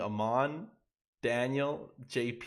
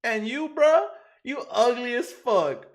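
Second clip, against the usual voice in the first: much higher and louder, slowing on the insult; mock Daniel roast; 11 semitones higher, 8 dB louder, slower than usual. Streamer insulting a viewer on live, high loud mock voice. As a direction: stretch out the playful insult in a high voice.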